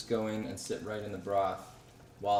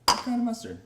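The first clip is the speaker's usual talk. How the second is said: mock-incredulous repeat of the question; mock surprised echo; 11 semitones higher, 6 dB louder than usual. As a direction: echo the question much higher and louder, playfully. Echoing cohost's question, playfully.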